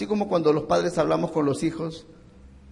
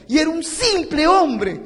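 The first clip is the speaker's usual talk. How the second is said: impassioned cry; 10 semitones higher, 6 dB louder than usual. Acted. Preaching climax.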